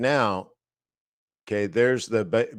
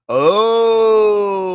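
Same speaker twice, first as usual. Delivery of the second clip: a sudden high wailing "Oh" much louder; high crying oh; 12 semitones higher, 11 dB louder than usual. Real, not acted. Trader reacting to a trade idea with a mock wail.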